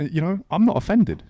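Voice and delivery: ordinary talk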